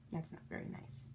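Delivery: hushed aside; quick disapproving whisper. Real, not acted. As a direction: drop to a whisper. Aside about mean laughter.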